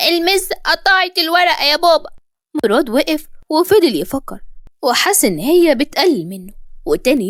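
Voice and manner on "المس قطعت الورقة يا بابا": put-on high, whining little boy; tearful child. A narrator's reading. Boy telling his father what teacher did, tearful. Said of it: "وفضل يعيط جامد قوي".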